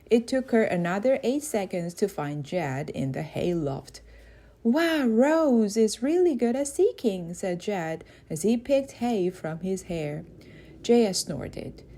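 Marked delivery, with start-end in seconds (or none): 4.6-7.3 put-on high, excited boy; amazed kid praising; impressed. A narrator's reading. Jed impressed.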